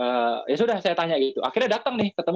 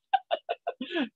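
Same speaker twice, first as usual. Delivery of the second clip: high-pitched, real giggle; high laugh, softer than speech; amused laugh; 5 dB quieter than usual. Real, not acted. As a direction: crack up at your own story. Laughs at the blunt proposal.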